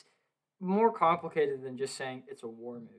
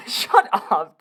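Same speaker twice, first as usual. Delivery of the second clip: laughing; 6 semitones higher, 11 dB louder than usual. Real, not acted. Laughing at own blunder.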